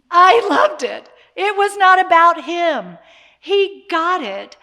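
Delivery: high, very loud burst of enthusiasm; delighted exclamation. Real, not acted. Mother celebrating her son's reaction to an audience, loud delight. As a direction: exclaim it proudly at full volume, pitch way up.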